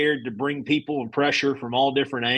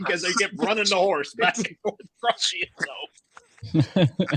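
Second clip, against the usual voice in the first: laughing; 6 semitones higher than usual. Real, not acted. Laughing while explaining the joke.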